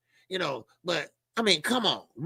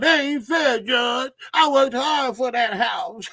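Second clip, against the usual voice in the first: mock whining plea; 6 semitones higher, 4 dB louder, slower than usual. Divorce court plea mocked.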